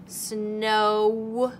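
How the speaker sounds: Snow stretched with a rise; stretching Snow for the class; sounding out slowly. Shown as a demonstration. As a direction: draw out Snow. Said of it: "say it really slowly".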